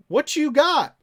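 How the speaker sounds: loud, high-pitched mock holler; pestering call-out. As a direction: holler it to get his attention. Acting out his pestering messages.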